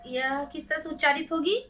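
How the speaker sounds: ordinary delivery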